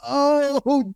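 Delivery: high, drawn-out "oh"; pained mock reaction. Coach hearing his client get owned, high mock wince. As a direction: wince aloud at someone getting crushed.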